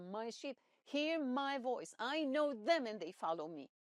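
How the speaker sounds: solemn scripture. Acted. Quoting God's word.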